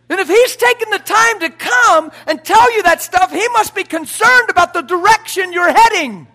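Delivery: loud, ringing preacher's declaration; full-force preaching. Acted. Pastor declaring to the church, loud. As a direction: hammer it home from the pulpit.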